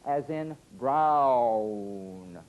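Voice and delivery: brown with a slow ow glide. Shown as a demonstration. Teacher slowly modelling the ow glide.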